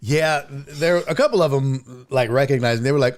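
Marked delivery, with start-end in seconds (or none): none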